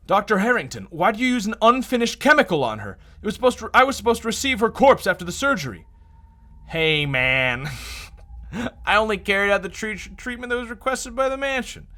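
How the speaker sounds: character voice. Acted. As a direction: lift the pitch and accuse.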